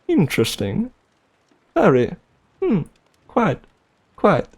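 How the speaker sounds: hammed-up upper-class British accent; posh British. Shown as a demonstration. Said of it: "British accent".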